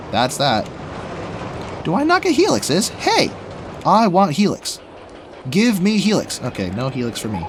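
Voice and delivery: usual speaking voice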